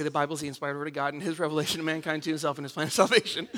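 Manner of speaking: bored rote answer. Retold or imitated. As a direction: rush through it half asleep. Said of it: "And I'd say".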